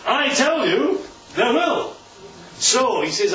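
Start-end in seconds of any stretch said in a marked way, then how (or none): 0.1-1.0 raised, ringing "I tell you"; emphatic declaration. Acted. Preacher voicing Jesus' command to the man, raised and emphatic. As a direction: proclaim "tell" in "I tell you" high and strong. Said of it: "And when Jesus says".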